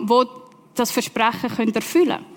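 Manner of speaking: ordinary talk, nothing marked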